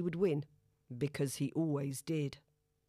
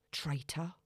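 muttered under the breath; 2 semitones lower, 5 dB quieter than usual. A narrator's reading. Zoe to Mitch the dog as she leaves, muttered. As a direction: mutter it quietly, just under your breath. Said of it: "mutters under her breath".

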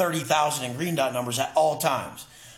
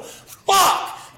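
a high, loud, breathy burst of frustration; frustrated outcry; exasperated outburst; 6 dB louder than usual. Real, not acted. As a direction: blurt it out, fed up with them.

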